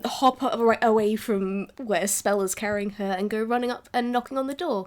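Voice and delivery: normal talking voice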